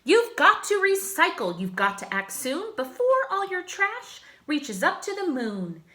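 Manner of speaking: rally the town like a cheerful superhero. Acted. Hero rallying the townsfolk, booming and bold.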